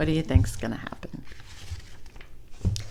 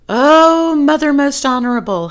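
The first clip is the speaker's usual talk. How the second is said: put-on high, loud, fast panting boy; 8 semitones higher, 10 dB louder than usual. Chang panting out his plea to his mother, high and loud. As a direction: gasp it out, out of breath. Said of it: "he panted".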